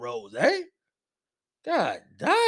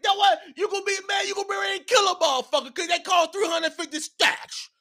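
excited shouting rant; 8 semitones higher than usual. Real, not acted. Excited rant about shoes.

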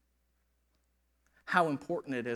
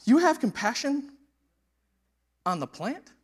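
voice of the Lord; 6 semitones higher, 9 dB louder than usual. Acted. God answering Jonah about Nineveh, solemn and measured. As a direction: speak as God reasoning with Jonah. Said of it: "Then the Lord said".

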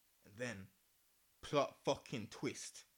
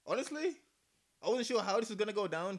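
higher and louder, excited; 8 semitones higher, 7 dB louder than usual. Real, not acted. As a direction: tell it all worked up. Animated excited telling.

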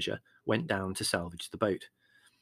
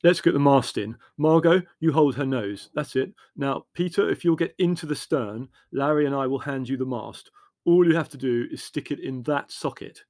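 brisk-minded man giving orders, raised pitch; organising; 3 semitones higher, 6 dB louder than usual. A narrator's reading. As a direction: take charge and issue instructions, a bit louder. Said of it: "organising things".

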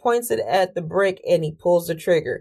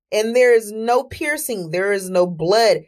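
normal talking voice

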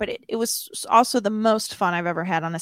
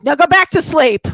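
high, loud retort; acted-out loud brush-off; defensive yell; 7 semitones higher, 11 dB louder than usual. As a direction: shout him down in embarrassment. Retold embarrassed snap at sleeper.

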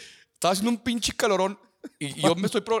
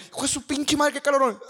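higher, hammed-up grumble about the heat; mock heat complaint; 9 semitones higher than usual. A sweaty guy cursing the heat, higher, mock exasperation. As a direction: play someone fed up with the sweltering day.

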